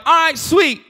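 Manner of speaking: high, mock-enthusiastic voice; hammed-up high-pitched yes; fake eager agreement. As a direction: agree brightly as a setup for a joke. Pharaoh's fake agreement before psych.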